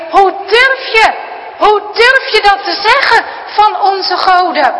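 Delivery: loud, high, raging grandpa; furious shout. Acted. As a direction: shout it with every blow. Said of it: "roept hij erbij".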